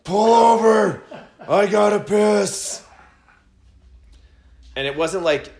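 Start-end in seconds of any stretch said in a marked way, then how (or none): 0.0-2.8 high, loud, slow desperate yell; urgent shout. Retold or imitated. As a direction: bellow like you're about to burst. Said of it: "come up out of his slumber and go".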